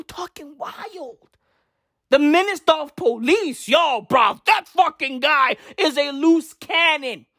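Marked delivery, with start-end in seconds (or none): 2.1-7.3 shouting in real frustration; angry rant. Real, not acted. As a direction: vent it hard at the minister. Ranting about the police minister.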